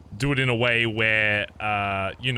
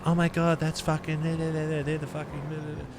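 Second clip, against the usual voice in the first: imitating a starstruck onlooker, higher pitched; awed bystander; 5 semitones higher than usual. A lightly voiced bystander gawking at the gang, higher. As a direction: gasp it out like an amazed passer-by. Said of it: "someone next to them going".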